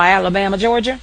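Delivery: ordinary talk, nothing marked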